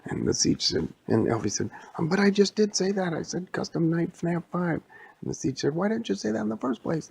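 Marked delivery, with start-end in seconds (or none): 2.0-4.8 high, hurried bickering voice; exasperated protest. Acted. Acted bickering between story characters. 5.7-7.1 high, rapid squabbling voice; exasperated bickering. Acted. Acted bickering between story characters.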